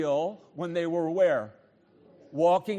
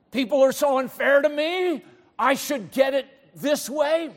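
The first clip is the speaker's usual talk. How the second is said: loud, raised-pitch griping; mock indignant complaint; 7 semitones higher, 7 dB louder than usual. Frustrated person complains about others, mock indignant. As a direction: grumble loudly in a hammed-up voice. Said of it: "But you're muttering".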